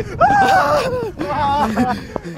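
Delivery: ein überdrehtes, schrilles Heulen; hammed-up wail. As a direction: wail it out in mock terror. Mock cry shout.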